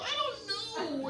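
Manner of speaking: high-pitched helpless protest; exasperated reply. Retold or imitated. Patient asked about childhood trauma mid-surgery, exasperated. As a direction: stretch it out in a strained high voice.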